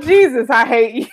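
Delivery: ordinary delivery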